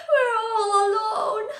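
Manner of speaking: high, loud, tearful cry. Acted. Gretel crying to Hansel in the dark forest. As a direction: wail it like a lost frightened child. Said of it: "Gretel cried".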